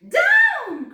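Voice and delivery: shouted high and loud command; witch crying out. A narrator's reading. Witch shouts to her passengers. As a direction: witch yelling down from the broom. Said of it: "cried the witch".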